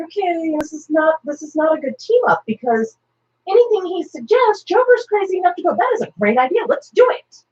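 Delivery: a nervous hero getting anxious, voice up; worried Captain America. Retold or imitated. Captain America worrying about the team-up, high and anxious.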